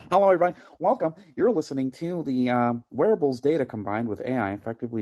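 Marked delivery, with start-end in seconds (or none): 0.1-3.7 higher, louder announcer-style welcome; mock radio host greeting, higher and louder; wound-up podcast intro. Shown as a demonstration. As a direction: open the show all wound up. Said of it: "now I have to talk like this".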